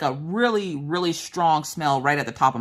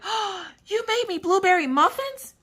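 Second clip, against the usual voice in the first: delighted gasp. Retold or imitated. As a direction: gasp then a much higher thrilled squeal. Thinks husband baked muffins.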